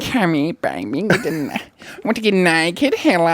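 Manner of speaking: high, goofy come-on; weird bedroom voice. Shown as a demonstration. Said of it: "That's not a bedroom voice".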